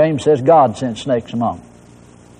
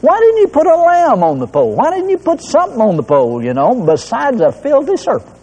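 mad at God; 8 semitones higher than usual. Retold or imitated. Preacher retells his own angry complaint to God, high and indignant. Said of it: "it used to make me mad".

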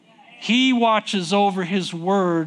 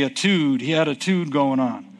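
slangy aside; 3 semitones lower than usual. Shown as a demonstration. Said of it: "Or as they say".

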